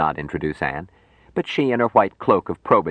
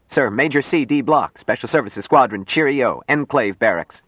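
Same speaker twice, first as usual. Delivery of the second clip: higher-pitched, louder report at attention; lightly voiced major reporting to the Secretary General; stiff young officer; 7 semitones higher, 4 dB louder than usual. A narrator's reading. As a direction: rattle off name and rank like a nervous soldier.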